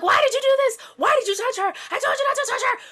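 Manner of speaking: loud, high hysterical reenactment; mock crazed yelling. Shooter accusing his friend, mock hysterical yelling. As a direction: play it up as a crazed outburst. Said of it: "he just goes crazy".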